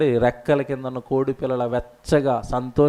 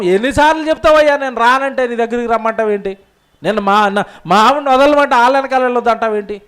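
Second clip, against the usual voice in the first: higher, louder exasperated complaint; angry retort; 7 semitones higher, 5 dB louder than usual. Retold or imitated. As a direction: snap back at God in irritation. Solomon angrily answering God.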